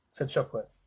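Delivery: ordinary delivery, nothing marked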